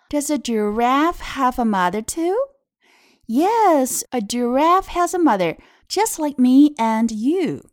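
slow, lilting picture-book reading; read-aloud to children. Shown as a demonstration. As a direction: read it out clearly for small learners.

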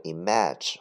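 image misread as im-ATCH; mispronunciation demo. Shown as a demonstration. Teacher warns students of another misreading, image mispronounced im-ATCH. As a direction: say image with an open "a" as in match. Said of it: "不读image或者image".

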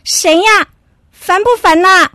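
high-pitched irritated grumble at the door; annoyed, just woken. Acted. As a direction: snap at whoever rang the bell. Dog woken by doorbell, annoyed.

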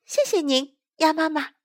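put-on high, polite child voice; small creature's high voice; little tadpoles. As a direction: thank her as a little tadpole. Tadpoles thank mother duck.

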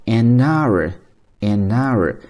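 "an hour" slowly as a-nour; drawn-out linked demo of "an hour"; linked reading. Shown as a demonstration. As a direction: slide the n into "hour", silent h. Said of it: "正确的读法，它是可以连在一起的".

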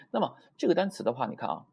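usual speaking voice

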